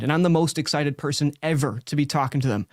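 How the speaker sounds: ordinary talk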